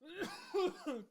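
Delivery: high, laughing splutter; choking cough. Real, not acted. Cereal eater laughs mid-mouthful, choking and coughing. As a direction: cough as if food went down wrong. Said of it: "God, I'm choking".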